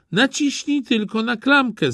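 put-on high, weak old grandmother calling out; a sick grandma calling to the door. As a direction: call from the bed like a frail granny. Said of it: "zawołała babcia".

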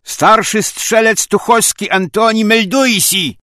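loud, high military report; crisp parade-ground bellow; soldierly shout. Acted. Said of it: "po żołniersku wykrzyknął".

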